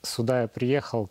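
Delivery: ordinary talk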